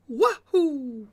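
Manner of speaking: high, loud, excited Mario cry; Mario wahoo. Retold or imitated. Streamer quoting her weekend Mario catchphrase, high excited whoop. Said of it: "all weekend I've been saying".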